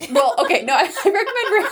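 high-pitched, breaking up with laughter; laughing. Real, not acted. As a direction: try to talk through a laughing fit. Breaks into laughter while correcting herself.

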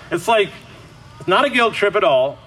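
usual speaking voice

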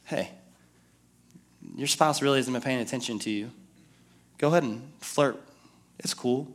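hushed voice of the tempter; tempting murmur. Retold or imitated. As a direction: lure him in softly like a tempter in his ear. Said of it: "Satan whispers".

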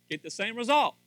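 loud, high climax of a sermon; preaching. Real, not acted. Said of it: "I'm getting a little preachy there".

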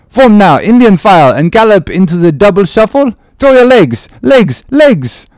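high, loud eager call to dance; lively French sailor. Acted. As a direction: rouse everyone to jig.

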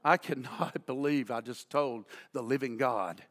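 low, hushed retold disbelief; subdued, stunned regret; guilty inner thought. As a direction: drop right down, as if thinking aloud. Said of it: "now he's thinking".

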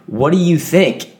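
a touch of a snappy, sarcastic answer; mock irritation at a dumb question; grumpy retort. Answering the phone at work, mock grumpy retort. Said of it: "And I'd be like".

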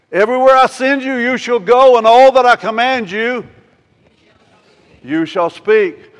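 high, loud, commanding proclamation; preacher booming God's words to the congregation; preaching at full force. Acted. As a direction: thunder out God's orders.

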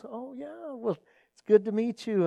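high, soft mock pleasantry; airy, quiet social nicety; polite small talk. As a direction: imitate an awkwardly polite reply. Pastor politely brushes greeting off.

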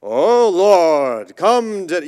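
loud, put-on churchy intoning; preacher mocking a showy prayer chant; mock spiritual prayer. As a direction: ham up an over-spiritual prayer voice. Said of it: "pray in a real spiritual way".

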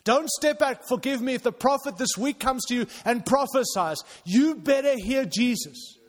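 loud, high-pitched sermon delivery; full-force preaching. Acted. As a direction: preach it loud and urgent to the congregation.

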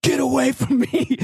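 high, sharp mock scream; wife in labor yelling at the nurse, high-pitched; retold scream at nurse. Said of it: "screams at him".

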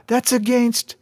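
very high-pitched objection, cut off; shouted protest. A narrator's reading. Girl objects to the attack, high narrated shout. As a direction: protest high, breaking off mid-sentence. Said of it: "Bree shouted".

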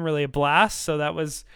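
ordinary delivery

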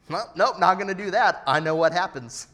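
emphatic, jokey self-warning; comic firm refusal. Acted. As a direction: refuse emphatically, playing it for laughs. Acts out quick refusal of sin.

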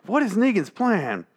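high-pitched, exaggerated bewilderment; baffled outcry. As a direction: wail the question in mock confusion. Acts out own baffled reaction.

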